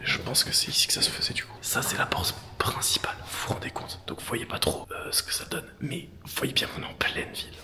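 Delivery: hushed whisper while exploring. Real, not acted. Visite urbex chuchotée.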